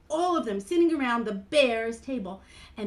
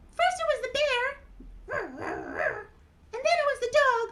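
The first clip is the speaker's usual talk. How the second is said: high puppet voice, counting off; Mimi; 3 dB louder than usual. Acted. Mimi recounts the guests, high puppet voice. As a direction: recite as a tiny character.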